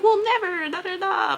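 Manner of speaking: high falsetto promise, hammed up; mock innocent. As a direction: imitate coworkers swearing they never would. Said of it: "they were like".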